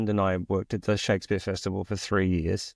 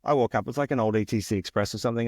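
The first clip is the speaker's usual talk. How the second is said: ordinary talk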